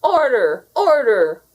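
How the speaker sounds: loud angry bellow for order; furious shout for order in court. A narrator's reading. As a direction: roar it like an outraged boss. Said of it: "Boss shouted".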